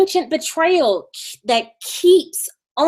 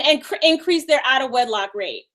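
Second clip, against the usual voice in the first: normal talking voice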